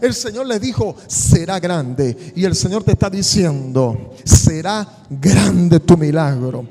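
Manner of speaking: loud, sustained pulpit proclamation; rousing sermon crescendo; preaching at full force. Acted. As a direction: preach it to the back row with fire.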